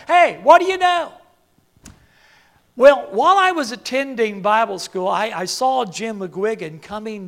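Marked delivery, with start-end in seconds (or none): 0.1-1.1 loud, high called-out hello; habitual hearty greeting. Acted. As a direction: hail someone heartily across a foyer. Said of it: "I always say that".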